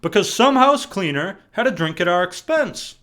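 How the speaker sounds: worked-up, aggrieved complaint; pissed off. Retold or imitated. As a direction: retell the outrage, punching it hard. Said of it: "I was pissed".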